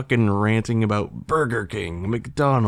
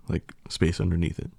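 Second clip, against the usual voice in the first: usual speaking voice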